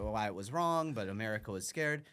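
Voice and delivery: ordinary delivery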